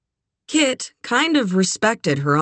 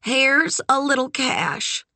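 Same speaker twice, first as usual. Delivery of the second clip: high, slow, gleeful lilt; 9 semitones higher, slower than usual. A narrator's reading. Eleanor hands her niece spending money, gleefully. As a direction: draw it out high with a spark of glee. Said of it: "she said with a gleeful spark".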